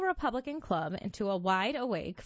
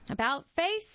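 military command; 8 semitones higher than usual. A narrator's reading. Chalker orders his uniformed boys to face the mob, commanding. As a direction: read as a crisp, raised order. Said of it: "he commanded".

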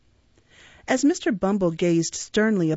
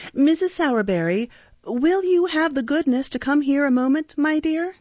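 higher, politely coaxing call; calling his wife; 7 semitones higher than usual. A narrator's reading. Undertaker summoning his wife to the shop, polite calling. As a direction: summon a spouse sweetly, pitch rising to the end.

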